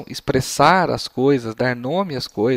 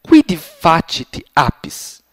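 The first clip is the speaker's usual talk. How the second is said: very slow, loud, spaced-out Latin question; teacher modelling the next question, very slow; 5 dB louder, much slower than usual. Shown as a demonstration. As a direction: ask it very slowly and clearly. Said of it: "Essa segunda pergunta".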